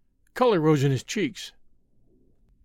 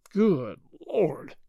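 slow-spaced, dismayed exclamation; drawn-out exasperation; exasperated repeat. A narrator's reading. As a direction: break the two words apart in disbelief. Repeats groan, pounding the table.

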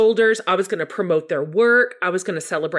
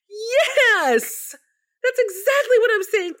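high, loud, petulant yes; jealous baby; 4 dB louder, slower than usual. Retold or imitated. Answering the Holy Spirit, petulant and jealous. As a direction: whine it like a jealous toddler. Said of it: "like a jealous baby in a diaper".

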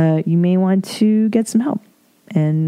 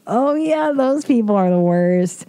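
exasperated agreement; 4 semitones higher than usual. Real, not acted. Groaning agreement about close talkers.

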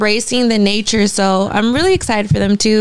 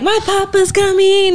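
imitating a child; 10 semitones higher than usual. Child quoted in story.

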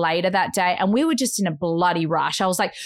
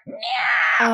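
imitated cat yowl. Storyteller imitating her cat flying up, yowl. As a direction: screech like a cat leaping.

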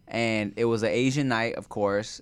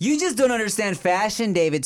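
mock scolding; 9 semitones higher than usual. Teasing co-host mock accusation.

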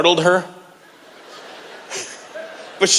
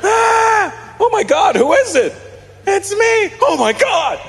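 panicked shouting; slower than usual. Retold or imitated. A couple hearing someone fall down the stairs, high mock-panicked shouting.

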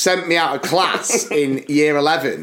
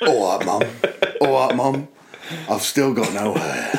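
low, gruff soap hard man greeting his mum; Ross Kemp impression; 4 semitones lower than usual. Returning soap tough guy to his mum, mock low voice. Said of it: "the equivalent of Ross Kemp coming back in EastEnders".

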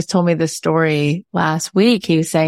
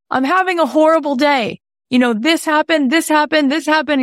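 mock upset; 10 semitones higher than usual. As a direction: play the stranger pouring out his bad day. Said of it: "said to my friend".

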